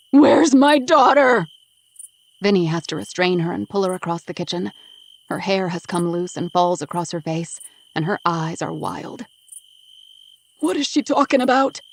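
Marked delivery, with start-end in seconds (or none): 0.1-1.5 even louder, slow, high wild scream. Acted. 10.6-11.8 high, loud alarmed protest. Acted. Bound man feigns confusion, shouting.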